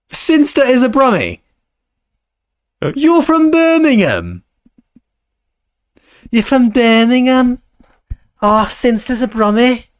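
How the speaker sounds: put-on Scouse accent. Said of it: "speak like I'm from Liverpool".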